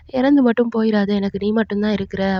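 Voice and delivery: normal talking voice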